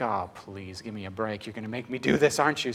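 low, soft grumble of giving in; mock weary plea under the breath; resigned, quiet and low, as retold; reluctant.

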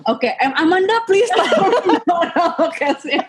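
pleading through laughter; laughing. Real, not acted. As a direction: ask for help while laughing. Laughing, asking for help.

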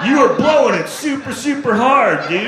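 the dog scolding a clueless stoner; annoyed dog telling him off; exasperated dog. Retold or imitated. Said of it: "the dog's like".

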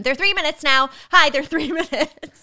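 loud, high-pitched plea for attention; insistent announcement. Retold or imitated. Patient telling everyone who could listen, insistent announcement. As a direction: call it out to anyone who will listen.

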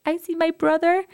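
put-on very high small-child voice; little daughter. Mother imitating daughter meeting baby brother, little-child voice. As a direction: imitate an excited toddler. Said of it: "and she's like".

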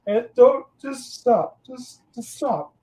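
high, strained plea to stop; choking up. Retold or imitated. Teacher pleading with students, high and near tears. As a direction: hold back tears and beg them to stop. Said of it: "teacher fighting tears, retold".

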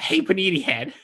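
very high, airy mimicry; airy mock impression. Contestant teasing the host's failed accent, high airy mimicry. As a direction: make it high and full of air, teasing. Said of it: "you being more airy with your voice".

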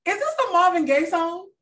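high, loud mock-astonished question; acted surprise. Host retelling hearing October London in a car, astonished.

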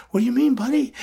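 high, puzzled question; surprised friend. Retold or imitated. Edge on the phone asking what he means, high and surprised.

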